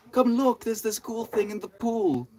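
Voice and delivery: hammed-up high-pitched excitement; excited invitation. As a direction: call friends over to see a marvel. Acting out excited poolside invitation.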